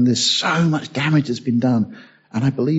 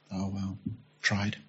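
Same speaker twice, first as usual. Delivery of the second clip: a much softer, lower, deflated sigh; resigned shrug; 6 semitones lower, 16 dB quieter, slower than usual. Pretend. Customer giving up on the lost parcel, deflated and quiet. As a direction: toss it off like it doesn't matter.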